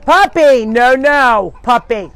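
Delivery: loud, high warning to a puppy; urgent scolding. Real, not acted. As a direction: call out to stop the puppy. Puppy trying to get in.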